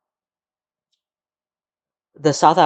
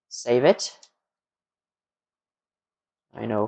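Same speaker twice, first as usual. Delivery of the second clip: ordinary talk, nothing marked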